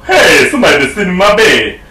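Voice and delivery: lightly voiced affronted father bear; Papa Bear. Father bear finding his bed used, mild indignation. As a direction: call it out with a hint of father bear. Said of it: "Pop Bear says".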